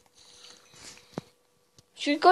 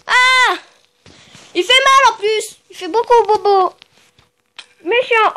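1 hurt whining; 7 semitones higher, 7 dB louder than usual. Real, not acted. Hit by archer, whining.

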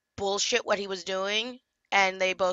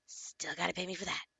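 muttered repeat; 2 semitones lower, 14 dB quieter than usual. Real, not acted.

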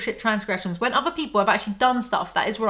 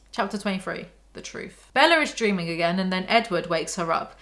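ordinary talk

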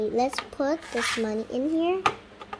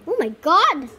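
loud, higher burst of pretend amazement; amazed exclamation; 4 semitones higher, 6 dB louder than usual. Shopper sees how big it is, loudly amazed. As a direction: exclaim with playful astonishment. Doll amazed at item's size.

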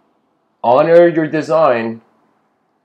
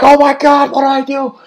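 much higher, louder panicked wail; panicked cry; 11 semitones higher, 6 dB louder than usual. Acted. Person torn apart in parable.

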